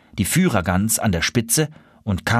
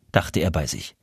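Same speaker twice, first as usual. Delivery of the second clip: plain reading voice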